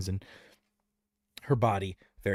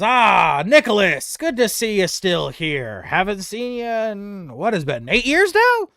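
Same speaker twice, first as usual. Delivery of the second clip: put-on louder, hearty greeting to an old friend; cheery old friend greeting; 9 semitones higher, 9 dB louder than usual. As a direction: Brood hails the goblin warmly. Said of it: "Brood looks to the old goblin and says".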